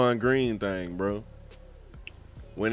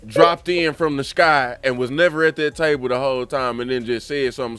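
ordinary delivery

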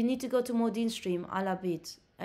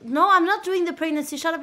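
higher and louder, flatly refusing the chat; loud refusal; 7 semitones higher, 9 dB louder than usual. Real, not acted. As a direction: shout down the dare. Refusing a viewer's dare.

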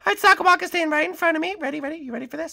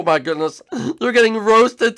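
usual speaking voice

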